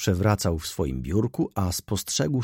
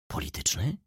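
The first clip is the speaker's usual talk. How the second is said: softer, anxious whispered-like question; quiet, fearful; 3 semitones lower, 6 dB quieter than usual. Acted. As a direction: ask it hushed, with dread. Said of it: "spytał cicho Rzecki z trwogą". (cicho, z trwogą)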